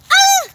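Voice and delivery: sharp high fright scream. Acted. Scared pupils screaming at the ghost cry. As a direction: shriek in sudden fear.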